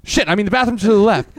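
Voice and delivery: imitating a loud, high-pitched oops; flustered self-correction. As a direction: blurt out the correction as the caught-out usher. Acts usher catching his own mistake.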